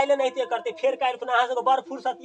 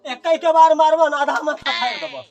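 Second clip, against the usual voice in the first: threatening shout; 3 semitones higher, 7 dB louder than usual. Acted. Brother threatens in fight.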